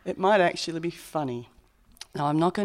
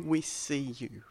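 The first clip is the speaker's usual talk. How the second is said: tender; 4 semitones lower, 9 dB quieter, slower than usual. Real, not acted. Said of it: "and to say".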